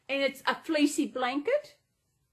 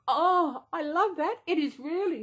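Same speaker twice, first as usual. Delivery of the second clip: higher and louder, charmed; delighted gush; 5 semitones higher, 3 dB louder than usual. Real, not acted. Spotting the pride flag on the blanket, delighted. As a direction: coo over the pride flag.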